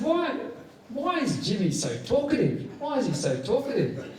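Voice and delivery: high, baffled asking why he talks so much; incredulous. Retold or imitated. Speaker quoting himself asking why Jimmy talks so much, high and baffled. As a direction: pitch it way up in disbelief. Mock incredulous question to mates.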